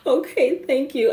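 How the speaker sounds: high, tearful phone voice; imitated crying. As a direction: thank them through mock tears. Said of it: "I'm crying on the phone".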